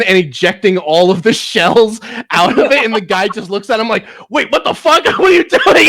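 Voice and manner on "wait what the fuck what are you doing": much higher, louder incredulous squeal; a gunman who can't believe what's happening; stunned thug. Retold or imitated. Thug watching Jackie Chan unload his shotgun, high stunned disbelief.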